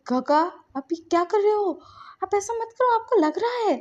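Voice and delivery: high, alarmed protest; shocked. A narrator's reading. Shinchan sees his uncle hurting his hand, shocked and pleading. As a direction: pitch leaping up in acted shock. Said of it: "Shinchan shocked".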